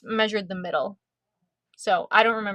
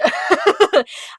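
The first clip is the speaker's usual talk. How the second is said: delighted squealing laugh; 9 semitones higher, 8 dB louder than usual. Real, not acted. Delighted over the gift.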